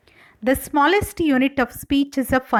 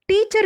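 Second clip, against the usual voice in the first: "teacher" with TEA louder than -cher; "teacher" said as TEA-cher, strong then weak; first-syllable stress; 8 semitones higher than usual. Shown as a demonstration. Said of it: "first syllable louder than the second".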